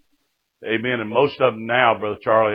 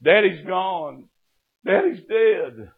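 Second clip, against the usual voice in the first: high, slow mock wail; imitated crying; 9 semitones higher, slower than usual. Sister-in-law telling of her father's death, imitated tearful wail. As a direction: draw out the bad news in a raised, grieving voice. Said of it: "Penny was crying".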